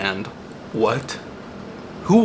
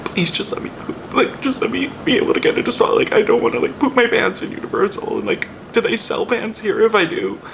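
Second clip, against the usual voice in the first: high, frantic mock prayer; imitating a panicked bathroom prayer; desperate plea; 10 semitones higher than usual. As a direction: beg God in a pinched, pleading voice. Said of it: "being like".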